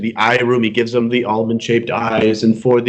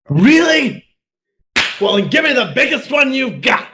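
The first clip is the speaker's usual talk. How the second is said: grab the free offer eagerly; hammed-up greedy glee, much higher; excited greedy shout; 11 semitones higher than usual. Armenian greedy for biggest nose.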